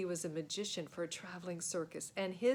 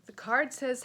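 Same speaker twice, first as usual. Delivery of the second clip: usual speaking voice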